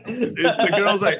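ordinary talk, nothing marked

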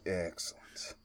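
low whispered villain gloat; Shang Tsung. Acted. Shang Tsung sitting back watching the cleaning, whispered villain voice. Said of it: "Shang Tsung sitting back like".

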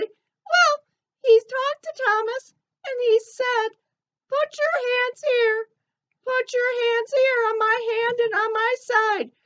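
high, squeaky cartoon elephant. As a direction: chirp it like a little elephant puppet. Ellie retells Jesus' words.

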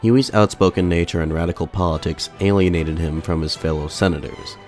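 normal talking voice

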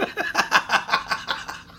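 high-pitched giggle. Real, not acted. Host laughs at teasing.

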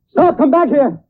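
high, loud command to halt; furious chase yell; angry shout. Acted. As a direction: bellow after the running villain. Shouting after fleeing villain.

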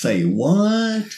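high, incredulous say what; genuinely taken aback; surprised. Real, not acted. Stunned by plenary genitive jargon.

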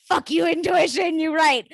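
loud, high-pitched mock outburst; retold exasperated outburst. Speaker giving in to her own intuition, exasperated outburst. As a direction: throw it out loud and high, conceding defeat.

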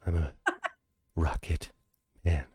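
spoken-sung, halting with a laugh; half-sing it like Shatner; Shatner spoken song. Pretend. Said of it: "speak a song like William Shatner did".

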